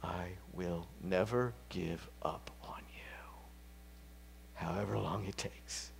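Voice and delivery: whispered divine reassurance; God's gentle whisper. Acted. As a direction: breathe the promise in a hush. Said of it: "a loving God says".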